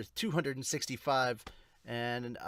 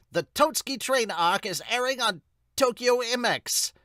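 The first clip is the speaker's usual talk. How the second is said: higher, louder ad-style read; promo announcement; 7 semitones higher, 6 dB louder than usual. Acted. Reader voicing the manga promo box, ad read. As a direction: sell the anime airing like a TV spot. Reads the anime promo blurb.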